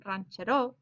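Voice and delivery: "ranchero" with the weight on the final "ro"; wrong stress, last syllable. Shown as a demonstration. Teacher showing a mistake, "ranchero" stressed on last syllable. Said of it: "it's not ranchero".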